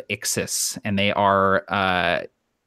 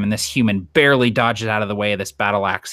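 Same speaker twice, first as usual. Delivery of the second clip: ordinary talk, nothing marked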